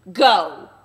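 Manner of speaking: loud final command; furious shout. Acted. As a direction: hurl the word at her. Quilp ordering wife out.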